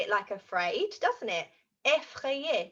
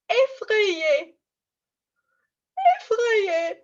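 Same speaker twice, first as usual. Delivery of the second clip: high, loud mock-frightened cry; scaredy-face squeal; acted scared; 9 semitones higher, 6 dB louder, much slower than usual. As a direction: play up the fear for children. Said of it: "show me your scaredy face".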